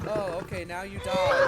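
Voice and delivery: a hint of a smug game taunting him; lightly mock the game's gloating; sing-song mock of the game. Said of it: "the game's just gonna be like".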